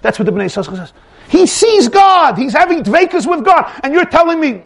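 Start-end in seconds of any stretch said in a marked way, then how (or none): 1.3-4.6 high, loud incredulous protest; stretched, fervent exclamation; impassioned outrage. Acted. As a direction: cry out in passionate disbelief. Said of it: "Zidichover is feeling".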